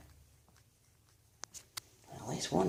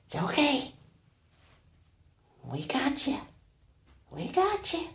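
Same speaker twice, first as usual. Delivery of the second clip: high pet talk; 13 semitones higher, 3 dB louder than usual. Real, not acted. Owner soothes a nervous cat in high baby talk. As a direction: reassure the frightened animal up high.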